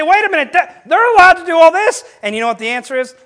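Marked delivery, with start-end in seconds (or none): 0.0-2.0 high, loud, indignant complaint; mock outrage, pitched way up; acted childish protest. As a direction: protest like a kid crying unfair. Acts out indignant child pointing at others.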